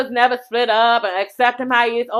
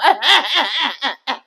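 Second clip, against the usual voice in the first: real laugh; 2 semitones higher than usual. Real, not acted. Host cracking up at the story, laughing. Laughs at her own joke.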